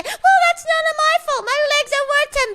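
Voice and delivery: high, hammed-up protest; overacted scammer insistence; mock indignant yell. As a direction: brag about your priceless legs. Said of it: "And I will say".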